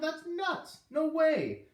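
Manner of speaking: high, loud protesting holler. Acted. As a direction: holler it like an outraged kid. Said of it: "Nick was hollering".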